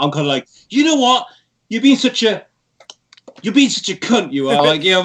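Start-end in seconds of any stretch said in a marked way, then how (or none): 0.7-4.6 high-pitched mock exasperation; playfully acted outburst, pitched high; acted annoyance. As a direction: tell someone off, pitch raised, not really angry. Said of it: "if someone's pissing me off now".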